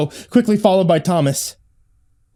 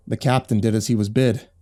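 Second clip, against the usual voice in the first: ordinary delivery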